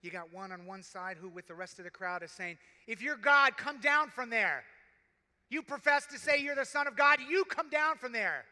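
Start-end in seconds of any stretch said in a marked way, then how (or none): 2.9-8.4 loud, higher jeering shout at the cross; mock him with a loud, sneering yell; a criminal on the cross taunting Jesus, loud and arrogant. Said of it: "You hear the arrogance of Satan?".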